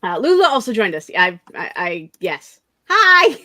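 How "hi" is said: high loud cheerful hi; excited greeting. Real, not acted. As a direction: greet a pet with delight. Greeting a cat joining.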